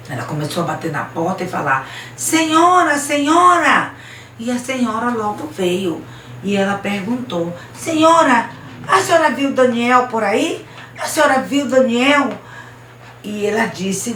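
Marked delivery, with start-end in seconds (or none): 2.2-3.9 high, loud call at the door. Acted. Girl calling at a door. 7.8-12.3 raised, louder, anxious questioning; urgent girl asking. Acted. Girl urgently asking after Daniel.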